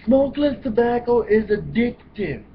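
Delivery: loud, high mock-alarmed reading; reading the warning. Reading the tobacco warning label to viewers, loud and high. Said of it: "And it says".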